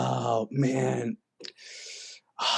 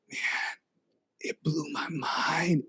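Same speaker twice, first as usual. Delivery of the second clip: hushed awe; 7 dB quieter than usual. Real, not acted. Awed retelling of whale encounter.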